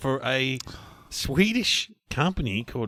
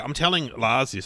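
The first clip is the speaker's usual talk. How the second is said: normal talking voice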